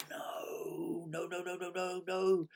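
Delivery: high, whimpering string of no's; a strangled panicked wail; horrified no. Retold or imitated. Caught swearing on the phone, squealing high panicked no's. Realises client heard swearing.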